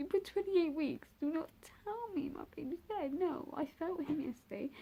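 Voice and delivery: high-pitched retold protest, not screamed; distraught denial. Mother telling the doctor he is wrong, distraught denial. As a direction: insist against the news in a high, upset voice. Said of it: "I'm crying, I'm screaming, I'm like".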